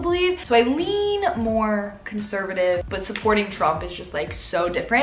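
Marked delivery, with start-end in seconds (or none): 0.4-2.8 slow, with "lean" leaned on hardest; slow down and press the word "lean"; "lean" drawn out and stressed. Shown as a demonstration. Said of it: "And I'm saying lean".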